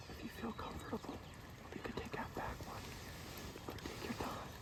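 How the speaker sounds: hushed hunting whisper. Real, not acted. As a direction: whisper so the birds can't hear. Whispered guidance during a hunt.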